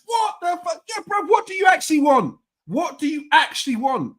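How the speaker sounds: stunned, demanding an answer; reacting to a fan's absurd reply, high and incredulous; incredulous outburst. Real, not acted.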